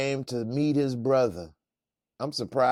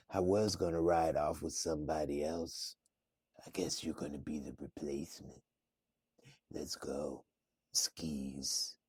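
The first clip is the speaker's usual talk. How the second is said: low whisper, softer; flirty whisper; 8 semitones lower, 11 dB quieter than usual. Retold or imitated. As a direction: breathe it like a seductive invitation. Woman whispers to the robber.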